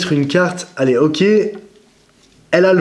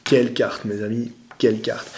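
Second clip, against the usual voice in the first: hushed, lower admiration; admiration à mi-voix; 4 semitones lower, 7 dB quieter than usual. Real, not acted. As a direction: say it softly, in quiet awe. Admiring the card just revealed. (admiratif, tout bas)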